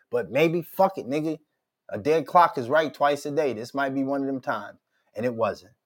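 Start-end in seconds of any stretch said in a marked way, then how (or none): none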